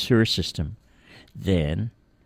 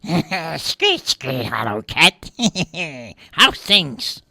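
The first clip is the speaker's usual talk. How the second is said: a lightly voiced rat with screechy noises; chirpy little rat greeting a cat; a hint of a screeching rat, chuckling; 7 semitones higher, much slower than usual. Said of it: "said the rat".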